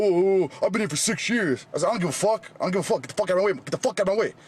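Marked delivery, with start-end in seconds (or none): 0.0-1.6 high-pitched mocking imitation of an indignant man; mocking retort. Gym-goer imitating the man who confronted him, mocking. 1.9-4.3 high, heated retort, repeated insistently; retold angry outburst. Gym-goer retelling his row with a stranger, heated.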